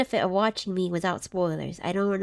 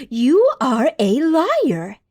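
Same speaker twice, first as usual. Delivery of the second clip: slow, drawn-out posh accusation, louder; posh accent; 2 semitones higher, 6 dB louder, much slower than usual. Retold or imitated. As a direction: put on a posh accent and stretch every word. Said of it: "she says in a posh accent".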